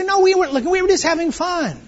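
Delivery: high, lightly voiced defensive excuse; a hint of a whiny congregant; mock excuse. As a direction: mimic them brushing it off.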